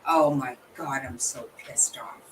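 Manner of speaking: fuming under the breath; whispered anger. Real, not acted. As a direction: whisper the fury to yourself. Muttering anger after the clip.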